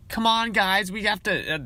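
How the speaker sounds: high eager classmate rallying the team; mock gung-ho cheerleader voice; gung-ho kid. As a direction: chirp it like the overeager kid. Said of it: "That's like the fucking kid in the class".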